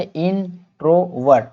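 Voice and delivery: इंट्रोवर्ट slowly as in - tro - vert; syllable-by-syllable. Shown as a demonstration. Teacher asks what it is, answers इंट्रोवर्ट slowly in pieces. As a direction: separate each part of इंट्रोवर्ट. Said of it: "pronounce करने में इस तरह से".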